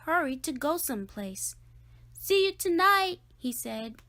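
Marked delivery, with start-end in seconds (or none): 2.1-3.2 high, loud parting call; hurried cheerful call. A narrator's reading. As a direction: call it over your shoulder. Reggie hurrying off.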